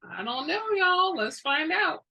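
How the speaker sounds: big, high, theatrical aside; hammy stage actor. Real, not acted. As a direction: ham it up for the audience. Playful aside to listeners after blurb.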